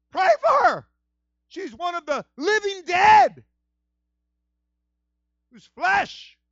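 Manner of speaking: high, loud pleading shout; desperate plea. Acted. Aaron begging Moses to pray, shouting. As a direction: beg him urgently.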